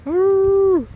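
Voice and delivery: high, loud cooing at the cows. Real, not acted. Farmer cooing high and loud at her grazing cows. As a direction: coo at them like babies.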